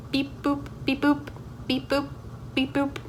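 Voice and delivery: even, staccato machine beeps; mechanical noise. Shown as a demonstration. Student demonstrating the class machine exercise, mechanical beeping. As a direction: beep like a simple little machine. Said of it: "a mechanical noise".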